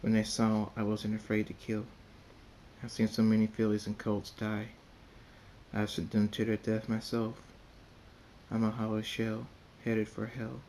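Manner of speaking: plain reading voice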